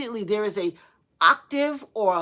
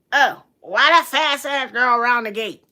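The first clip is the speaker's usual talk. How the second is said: gossipy judging voice; 2 semitones higher, 8 dB louder than usual. Retold or imitated. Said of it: "people that be like".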